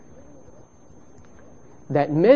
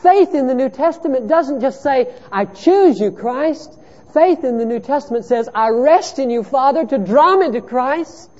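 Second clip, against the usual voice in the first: high, loud, driving pulpit voice; impassioned sermon delivery; fervent preaching; 13 semitones higher, 6 dB louder than usual. Acted. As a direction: preach it out to the whole room. Preaching at full force.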